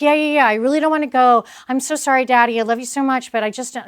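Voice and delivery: mock little girl begging dad; imitating daughter. Daughter pleading with her dad, childlike. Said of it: "And she says".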